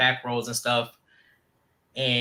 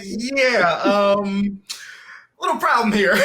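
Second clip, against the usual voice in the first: high, loud, laughing through the words; laughing mock reply; 6 semitones higher, 6 dB louder, slower than usual. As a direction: play the innocent, cracking up. Imitating friend in class.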